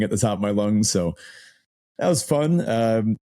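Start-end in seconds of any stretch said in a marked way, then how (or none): none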